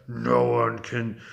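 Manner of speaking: low, slow dragon voice; boastful dragon. A narrator's reading. Dragon to the witch he burns, slow boasting. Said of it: "The dragon said".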